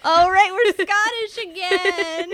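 laughing while talking; giggly, half laughing. Real, not acted. Joking about Scottish accent.

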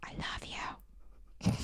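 breathy whispered I love you; whispering. Acted. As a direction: whisper it like a slow jam. Sexy whispered interlude.

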